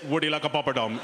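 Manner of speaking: lightly put-on Indian waiter accent, laughing; hint of an Indian waiter's accent. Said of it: "he said".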